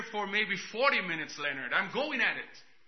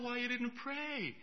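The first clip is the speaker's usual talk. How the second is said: higher, hushed divine question; soft voice of the Lord; 6 semitones higher, 10 dB quieter than usual. Retold or imitated. The Lord quietly questioning the preacher, hushed and higher. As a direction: gently ask it from above. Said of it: "The Lord said".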